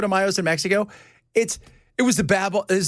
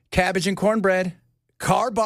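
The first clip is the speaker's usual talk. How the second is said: usual speaking voice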